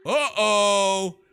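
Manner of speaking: loud, high, alarmed uh-oh; a spooked yelp of uh-oh; startled uh-oh. Real, not acted. Sudden startled reaction.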